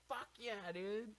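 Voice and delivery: high-pitched imitation of an eager friend; excited agreement. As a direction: jump at the offer, acted out high. Retelling Dylan's eager yes.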